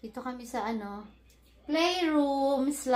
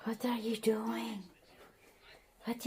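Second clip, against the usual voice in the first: slow, soft whispered question; hushed playful whisper; 3 semitones lower, 11 dB quieter, slower than usual. Real, not acted. Whispering to the baby.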